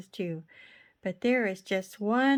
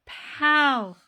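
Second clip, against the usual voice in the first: loud pow; 6 semitones higher, 8 dB louder than usual. A narrator's reading. Ball hits his hat.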